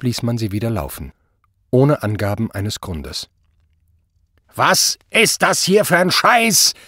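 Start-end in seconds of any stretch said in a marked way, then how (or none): 4.5-6.7 high, loud, slow outburst; blustering anger. A narrator's reading. Obergruppenführer confronts Zott in his office, blustering. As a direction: thunder at a subordinate, drawing out the words. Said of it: "polterte Obergruppenführer Prall".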